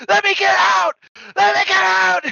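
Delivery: hammed-up trapped wail; screaming. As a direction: scream it high like someone trapped in a car. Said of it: "be-, like, screaming. He's like".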